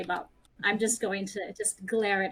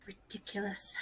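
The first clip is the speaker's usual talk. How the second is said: whispered scoff. Acted. Whispered repetition.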